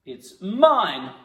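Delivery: loud, high snap; possessive punch. Pretend. Actor grabbing pencil back, loud and possessive. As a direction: claim it hard.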